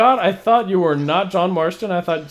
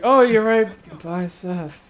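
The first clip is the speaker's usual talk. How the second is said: fake enthusiasm; slower than usual. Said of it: "I tried to sound really excited about it".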